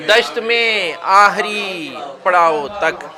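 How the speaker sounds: declamatory verse with measured pauses; mushaira ghazal recitation. Acted. Poet presenting ghazal couplets, measured recitation. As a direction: repeat each line with fuller projection, drop on the refrain.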